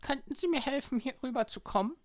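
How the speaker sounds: gespielte alte Frau, zittrig höflich; alte Dame. Acted. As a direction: als alte Oma sprechen. (alte Dame)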